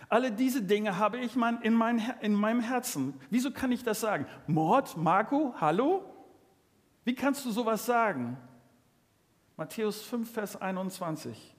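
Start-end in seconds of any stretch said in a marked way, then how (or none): 4.5-8.4 shocked listener. Acted. Voicing a shocked listener's objection.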